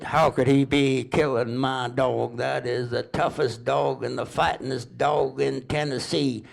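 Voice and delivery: lightly voiced Southern drawl, rising to high indignation; a hint of a Tennessee drawl, getting worked up; indignant Tennessee drawl. Dog owner protests in a light Tennessee drawl. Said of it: "they talk kinda slow and drawl down there".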